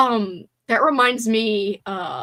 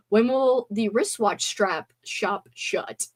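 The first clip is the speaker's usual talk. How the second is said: slow, careful articulation; slow tongue twister; slower than usual. Shown as a demonstration. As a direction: spell out each word slowly. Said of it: "That's another one".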